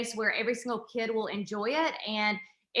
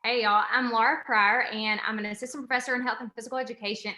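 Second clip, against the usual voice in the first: usual speaking voice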